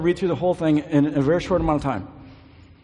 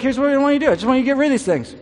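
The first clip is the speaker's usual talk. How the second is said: rushed and offhand, higher and fast; quick and flippant; 7 semitones higher, 3 dB louder, much faster than usual. Retold or imitated. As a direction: rattle it off like it's nothing. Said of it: "he moves through 'em so quickly".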